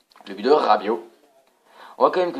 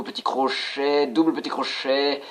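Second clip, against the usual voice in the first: ordinary delivery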